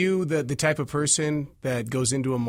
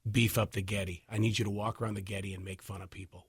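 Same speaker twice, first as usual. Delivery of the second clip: dude voice; 6 semitones lower, 7 dB quieter than usual. Retold or imitated. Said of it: "somebody came up to you and said".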